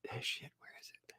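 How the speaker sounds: unvoiced muttered whisper; whispered to self while hunting for a clip. Real, not acted. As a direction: breathe it while searching.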